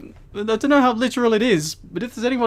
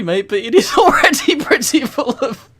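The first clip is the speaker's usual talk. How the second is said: louder, laughing through the words; teasing the author through giggles; giggling aside; 5 semitones higher, 7 dB louder than usual. Real, not acted.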